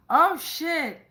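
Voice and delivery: sudden startled curse; surprised. Real, not acted. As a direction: caught off guard by the card. Reacting to a scary card.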